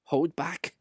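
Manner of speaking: ordinary delivery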